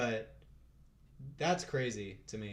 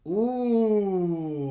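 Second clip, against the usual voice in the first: impressed ooh; 6 semitones higher, 7 dB louder than usual. Real, not acted. Reacting to the weight.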